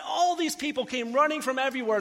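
ordinary talk

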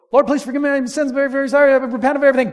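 high, loud, rushed plea; panicked last-second prayer. Retold or imitated. Driver careening off a cliff begs God, panicked and loud. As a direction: gabble it out in terror as the car falls. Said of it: "you're careening off the cliff and you go".

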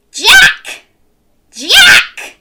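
high, loud calling out; roaring mom. Acted. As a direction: bellow for the boy. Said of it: "she roared".

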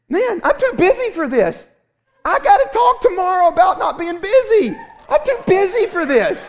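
high, loud mock complaint; hammed-up busy dad whining; whiny grumble. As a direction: whine like an overworked dad. Preacher voicing his grumbling self.

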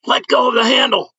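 high, loud alarmed shout; yelling. Retold or imitated. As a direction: yell it in alarm. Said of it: "A scream of".

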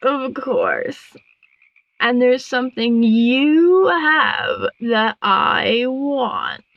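very slow, swelling villain drawl; scheming frog queen. A narrator's reading. Frog queen demanding something of the moth, gloating. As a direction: draw it out greedily, building in the middle.

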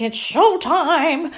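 high, slow, loud showman announcement; clownish showtime call. Retold or imitated. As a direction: belt it out like a class clown on stage. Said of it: "I was just like this clown".

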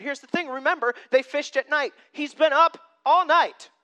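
normal talking voice

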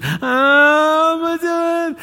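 high, loud wail of mock panic; drawn-out, hammed-up panicked cry. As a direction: cry out as if suddenly overwhelmed by doubt. Acts out rush of panicked feelings.

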